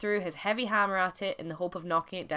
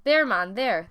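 impatient cry; 2 semitones higher, 8 dB louder, slower than usual. A narrator's reading. Wife shows husband where the bannock is, impatient cry. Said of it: "the wife cried".

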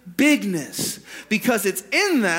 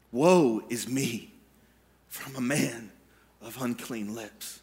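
sober confession; 7 semitones lower, 6 dB quieter than usual. Acted. Isaiah admitting the gap from God, subdued confession. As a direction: let it sink softer with each phrase.

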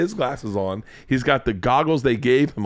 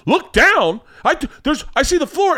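high, alarmed imitation of a dad; mock panic climbing higher and higher; panicked dad; 12 semitones higher, 4 dB louder than usual. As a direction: protest like a dad scared of heights. Acting out dad panicking at heights.

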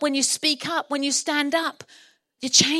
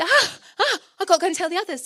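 high, gasping flurry of excitement; gasp, then blurt it out; flustered excitement; 5 semitones higher, 4 dB louder, faster than usual. Acted. Rhoda leaves Peter to tell the others, flustered.

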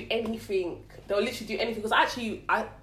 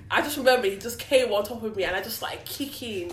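ordinary talk

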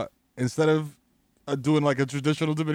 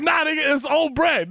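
high, insistent mock indignation; livid aunt; 10 semitones higher, 3 dB louder than usual. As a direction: insist stubbornly as the angry aunt. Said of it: "She's like".

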